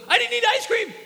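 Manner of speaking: put-on high, loud kid's yelp, rushed; little boy's rushed denial. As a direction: blurt it out fast like a panicked toddler. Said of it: "and he goes".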